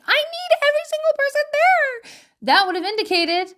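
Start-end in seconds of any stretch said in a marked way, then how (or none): 0.0-2.1 put-on high, pleading kid voice, much higher; demanding kid. Parent imitating a son insisting on everyone at his party, high kid voice. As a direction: whine it like a needy child. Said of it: "he didn't say".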